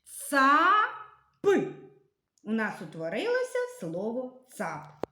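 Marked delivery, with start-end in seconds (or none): 0.0-0.9 «Цап» said loud and higher; reading the answer. Shown as a demonstration. Teacher revealing the word to the class, loud and bright. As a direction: announce «Цап» as the solved word. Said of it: "Читаємо".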